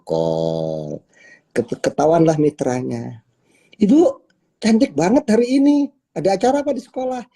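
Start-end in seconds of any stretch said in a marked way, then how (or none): 3.8-7.3 much higher and louder, fast gushing compliment; flattering leader. Acted. Leader complimenting the member on a video call, gushing high praise. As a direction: gush over her like a delighted friend.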